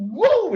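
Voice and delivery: high, loud "woo" mimicking a ball that flutters; a high loud woo for a ball dancing through the air; imitated ball whoosh. Imitating ball flying oddly.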